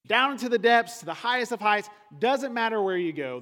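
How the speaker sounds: loud, high, rising sermon climax; preaching at full force. Acted. Pastor to congregation, a loud, rising proclamation. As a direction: proclaim God is everywhere to the congregation.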